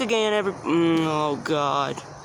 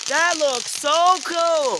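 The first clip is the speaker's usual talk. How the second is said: raised, slow, drawn-out delight; excited awe; 5 semitones higher, much slower than usual. Real, not acted. Vlogger admiring fireworks, excited awe. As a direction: stretch it out, thrilled by the sight.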